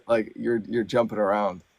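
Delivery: normal talking voice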